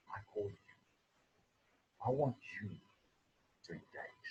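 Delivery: very soft, voicing God's call; quiet, intimate quote from God; hushed divine call. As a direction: drop right down, with solemn pauses. Voices God's personal call, hushed.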